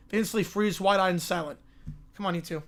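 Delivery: usual speaking voice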